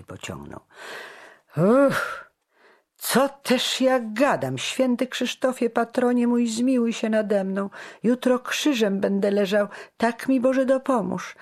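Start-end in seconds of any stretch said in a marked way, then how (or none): 1.6-11.4 high, loud frantic prayer; raised, urgent plea for mercy; alarmed pleading. A narrator's reading. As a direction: catch yourself, then beg the saint loudly. Startled self-reproach and plea to saint.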